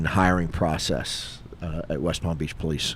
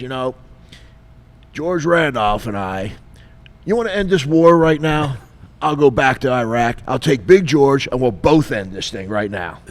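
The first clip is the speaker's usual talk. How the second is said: high, loud army bravado; booming sergeant boast; 7 semitones higher, 8 dB louder than usual. Retold or imitated. Sergeant bragging about George to the caller, high and loud. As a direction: bellow it like a proud old sergeant.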